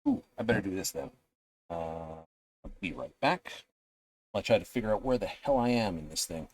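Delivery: usual speaking voice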